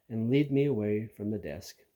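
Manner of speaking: ordinary delivery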